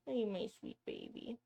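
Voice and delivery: quiet cooing question. Real, not acted. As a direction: coo it aloud to the pet. Cooing to her pet.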